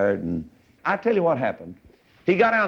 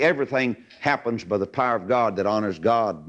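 ordinary talk, nothing marked

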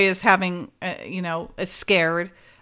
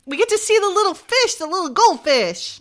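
cutesy voice; 8 semitones higher, 4 dB louder than usual. Real, not acted. To co-hosts about the adorable goldfish, high cutesy coo. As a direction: coo it like you're gushing over a pet. Gushing over a cute goldfish.

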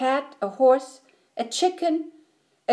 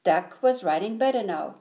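usual speaking voice

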